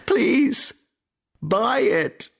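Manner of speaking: very high, loud begging; pleading cry. A narrator's reading. The young man fallen to the ground begging Kiawe, desperate cry. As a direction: beg desperately, pitched right up. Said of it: "he cries".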